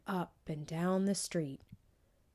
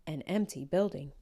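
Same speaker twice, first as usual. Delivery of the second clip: ordinary delivery, nothing marked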